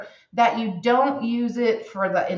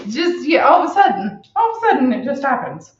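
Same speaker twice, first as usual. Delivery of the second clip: laughing; 6 semitones higher, 6 dB louder, faster than usual. Real, not acted. Cracking up at own impression.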